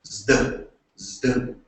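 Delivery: Zd as one buzzed z-plus-d cluster; Greek zeta sound. Shown as a demonstration. Lecturer demonstrating the Greek Z, Zd as "zd". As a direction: sound out Zd slowly, twice, separately. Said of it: "The Z sound is really not".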